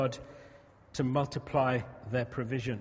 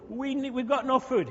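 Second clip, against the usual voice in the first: whiny grumble; 8 semitones higher, 3 dB louder than usual. Acted. Israelites whining voiced.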